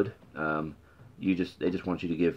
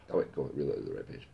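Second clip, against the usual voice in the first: plain reading voice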